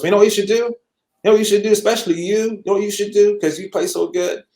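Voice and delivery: loud, high-pitched excited urging; really hyped. Retold or imitated. Friend urging a young drummer toward a teacher, hyped. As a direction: push the advice hard, all hyped up. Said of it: "he was really hyped about it".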